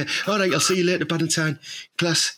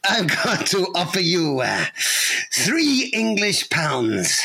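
very slow, drawn-out fake Greek accent; hammed-up Greek accent, stretching every word; bad Greek accent; much slower than usual. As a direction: announce it grandly in a terrible Greek accent. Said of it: "the worst Greek accent I've ever heard".

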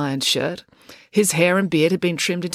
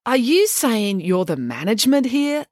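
slow, higher, scornful question; incredulous challenge; 7 semitones higher, slower than usual. Acted. April challenging the waitress over who manages, incredulous. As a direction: draw it out in disbelief.

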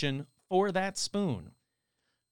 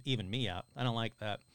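ordinary talk, nothing marked

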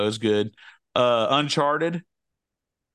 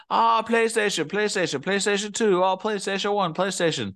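higher, put-on accent on PlayStation; mocking fans in a silly accent; 8 semitones higher than usual. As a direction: chant PlayStation in a made-up accent. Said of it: "giving it, like, an accent".

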